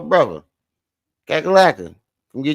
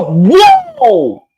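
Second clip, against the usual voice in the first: much higher, louder shocked whoa; high startled whoa; 10 dB louder than usual. Real, not acted. Watching the clip, he yelps in shock. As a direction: cry out in surprise.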